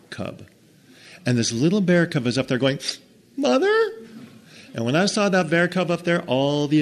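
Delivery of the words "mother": tiny cub voice. Acted. Bear cub calling.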